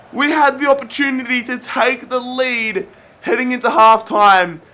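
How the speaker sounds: ordinary talk, nothing marked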